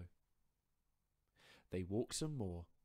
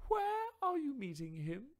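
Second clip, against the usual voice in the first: high, hooting owl voice, inviting; 10 dB louder than usual. Acted. Owl inviting the mouse for tea, high and hooting. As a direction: owl luring the mouse home.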